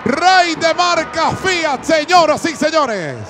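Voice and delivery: goal scream. Real, not acted. Commentator to the audience on Táchira's goal, roaring. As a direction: stretch the gol for all your lungs.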